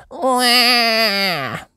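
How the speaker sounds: high wailing wah; little pig cry. A narrator's reading. George crying as his hat blows away, high wail. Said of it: "cries George".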